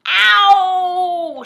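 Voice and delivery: long, high, loud yell; drawn-out howl of pain; shouted ow. A narrator's reading. Saucepan man above the children, shouting ow. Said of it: "shouted the saucepan man".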